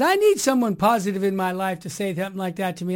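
normal talking voice

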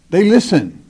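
ordinary talk, nothing marked

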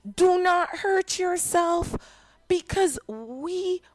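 calling out high and slow; loud call. A narrator's reading. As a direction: call out to stop him, unhurried. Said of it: "Paul called out in a loud voice".

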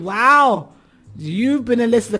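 loud, high burst of amazement; amazed. Real, not acted. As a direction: exclaim in delighted surprise. Amazed at long-time listener.

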